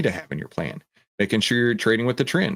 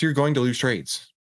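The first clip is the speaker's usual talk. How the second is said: ordinary delivery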